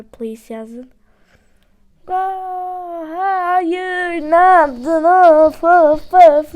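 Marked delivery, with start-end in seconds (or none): 2.1-6.5 loud exasperated "of" sighs; frustrated groans. Real, not acted. Game choice goes wrong.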